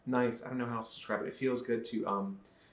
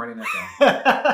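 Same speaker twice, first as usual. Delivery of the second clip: loud, high laughing delight; 12 dB louder than usual. Real, not acted. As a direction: laugh it out, thrilled. Delighted by episode title.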